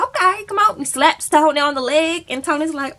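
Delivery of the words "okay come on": eager pastor. Retold or imitated. Brother Joey voiced.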